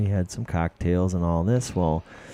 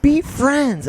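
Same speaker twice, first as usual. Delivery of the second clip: much higher, louder, slow plea; whiny pleading Coy; 5 dB louder than usual. Retold or imitated. Host imitating Coy begging two dogs to get along, high and whiny. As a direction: whine at the dogs to make peace. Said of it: "I'm like".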